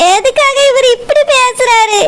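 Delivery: slow, weeping to herself; sobbing lament. Acted. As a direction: cry alone after the fight. Wife alone, crying after husband leaves. (தனியாக அழுதபடி புலம்புதல்)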